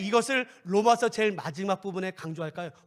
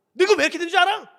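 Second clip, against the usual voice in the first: higher, louder scolding challenge; rebuking; 8 semitones higher, 8 dB louder, faster than usual. Acted. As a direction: call them out sharply. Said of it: "막 책망".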